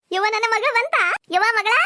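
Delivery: high, eager call to a daughter; excited mother's welcome. Acted. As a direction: call out joyfully to your girl. Mother greets arriving daughter. (ಮಗಳನ್ನು ಕರೆಯುವ ಖುಷಿಯ ಕೂಗು)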